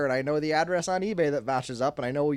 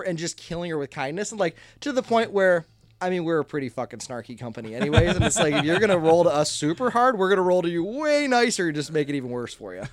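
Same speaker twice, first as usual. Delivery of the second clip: usual speaking voice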